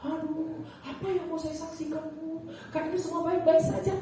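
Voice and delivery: high-pitched plaintive whine; acted-out reluctant whining; reluctant protest. Acting out own reluctant protest.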